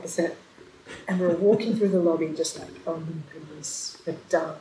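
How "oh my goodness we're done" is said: very soft, worn-out sigh; weary sigh of relief. Retold or imitated. Exhausted bride to family in the lobby, weary and soft. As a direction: breathe it out like you're finally done.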